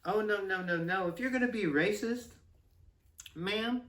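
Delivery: string out the no's in protest, then correct her; indignant mom. Retold or imitated. Mom marching back to confront the teacher, indignant correction. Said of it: "and was like".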